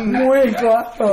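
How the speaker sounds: put-on slow, drawn-out Spanish-accented echo. Friend echoes the Latin flirt, drawn out slowly. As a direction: copy the Latin flirt, stretched long.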